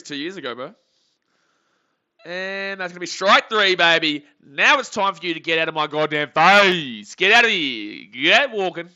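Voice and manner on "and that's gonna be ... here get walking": much louder celebration; real loud gloating at the batter; gloating shout. Real, not acted. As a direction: yell him off the field. Celebrating a strikeout.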